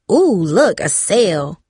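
ordinary delivery

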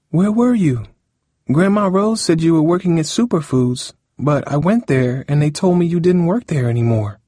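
high, anxious questioning; high-pitched girl, upset and questioning; worried Cindy. Acted. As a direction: press him in a high, worried voice.

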